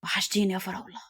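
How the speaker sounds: soft, tender murmur; lovingly. A narrator's reading. Lover greeting her after separation, tenderly. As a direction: say it softly, full of love. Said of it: "وهو بيقول بعش".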